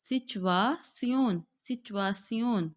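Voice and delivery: सिचुएशन as "zi-tu-a-tsion", very slowly; slow German सिचुएशन; German pronunciation. Shown as a demonstration. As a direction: model German सिचुएशन carefully. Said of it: "इसकी sound जो है वो क्या आ रही है".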